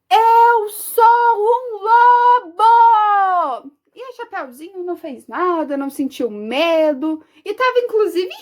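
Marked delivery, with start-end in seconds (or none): 0.1-3.7 long, drawn-out loud howl of words; big howling wolf yell; wolf's shout. Acted. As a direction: bellow it high, stretching every word slowly. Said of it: "Eu vou gritar".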